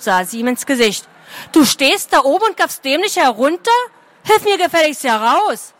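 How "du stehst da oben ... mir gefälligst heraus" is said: put-on a bossy girl snapping from the pit; girl in a pit scolding a gawking boy, cross; angry girl. A narrator's reading.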